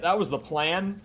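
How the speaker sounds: ordinary talk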